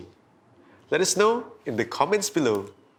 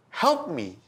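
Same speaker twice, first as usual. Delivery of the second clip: plain reading voice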